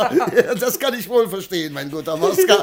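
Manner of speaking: delighted by the plot; laughing along. Acted. Merchant to servant, laughing at the plot.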